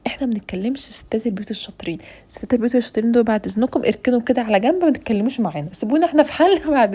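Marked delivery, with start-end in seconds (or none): 0.1-2.5 whispered aside, as if sharing a secret; conspiratorial whisper. Real, not acted. Vlogger whispering to new brides about expert housewives, teasing. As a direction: drop to a whisper for the joke.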